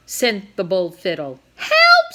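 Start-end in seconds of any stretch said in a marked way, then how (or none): 1.6-2.1 high, loud cry for help; shrill loud scream for help. A narrator's reading. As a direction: yell it out in panic. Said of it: "screamed a clerk".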